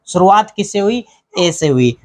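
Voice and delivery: usual speaking voice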